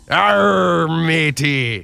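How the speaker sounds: loud growly pirate Arr; hammed-up pirate talk. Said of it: "Talk Like a Pirate Day".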